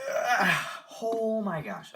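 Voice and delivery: high, loud exasperated cry; loud, high burst of frustration; strained cry. Real, not acted. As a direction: yell out as the effort fails. Full-power pull on stuck wheel.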